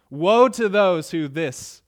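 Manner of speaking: loud, slow proclaiming of woe; preacher thundering the woe; emphatic woe. Acted. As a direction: ring out the warning slowly. Reading Jesus' woes with force.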